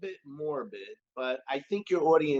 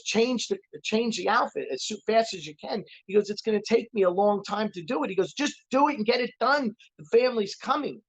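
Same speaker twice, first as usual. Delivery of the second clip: raised, pressing voice of a hurried boss; urgent boss; 7 semitones higher than usual. Retold or imitated. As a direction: push him to hurry, pitch up. Boss urging in joke.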